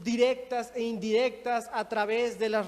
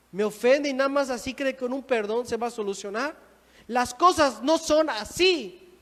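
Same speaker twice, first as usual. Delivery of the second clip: indignant; 5 dB louder than usual. Acted. Offended believer rejecting a brother's apology, indignant. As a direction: protest in mounting indignation.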